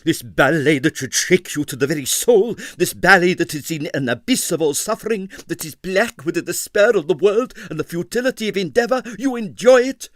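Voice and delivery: an affronted artist working up to rage; livid. Acted. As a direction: build from indignation to a furious peak. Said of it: "said Navashno, livid".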